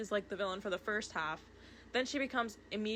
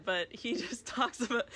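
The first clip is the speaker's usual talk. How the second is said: ordinary talk, nothing marked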